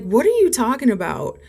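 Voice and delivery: high, incredulous retort; retold baffled, affronted question; indignant bafflement. Retold indignant reply on phone.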